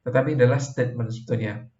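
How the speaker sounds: ordinary talk, nothing marked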